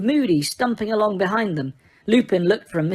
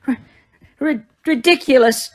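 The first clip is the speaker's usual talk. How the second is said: stuttering, sobbing cry, loud and high; sobbed; 6 semitones higher, 5 dB louder than usual. A narrator's reading. Mrs Weasley sobs the spell at the boggart, loud and high. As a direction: force the spell out through sobs. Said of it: "Missus Weasley sobbed".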